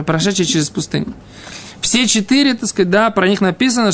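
normal talking voice

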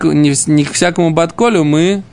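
ordinary talk, nothing marked

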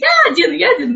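put-on high eager little child; a toddler rushing to volunteer. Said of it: "Говорит".

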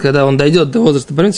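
usual speaking voice

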